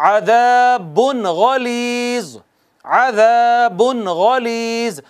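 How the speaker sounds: अज़ाबुन गलीज़ slowly, the नून clearly sounded; slow recitation. Shown as a demonstration. Teacher modelling the correct reading before गैन. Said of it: "नहीं कहेंगे चूँकि आगे गैन है".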